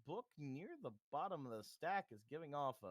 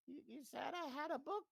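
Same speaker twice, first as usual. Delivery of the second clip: put-on old woman voice; 11 semitones higher, faster than usual.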